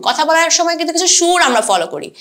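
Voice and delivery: ordinary delivery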